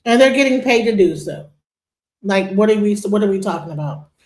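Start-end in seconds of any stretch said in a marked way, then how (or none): none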